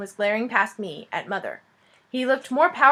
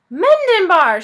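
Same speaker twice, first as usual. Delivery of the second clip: loud, high call across the crowd; shouted; 9 semitones higher, 11 dB louder, slower than usual. Acted. Dragon calling the king across the crowd, shouting. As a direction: shout the name in welcome. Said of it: "shouted one of the dragons".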